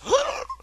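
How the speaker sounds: high startled intake of breath; quick frightened gasp; sharp gasp. Acted. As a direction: gasp as if stung by the name. McGonagall flinches at the name.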